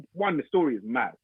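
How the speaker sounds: normal talking voice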